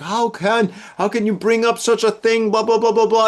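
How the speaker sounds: high-pitched mock scolding mother; upset mom. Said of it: "and she's upset".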